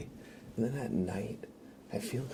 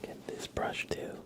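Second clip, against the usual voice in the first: ASMR whisper; 7 semitones higher than usual. Acted. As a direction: whisper intimately while brushing. ASMR brushing and chat.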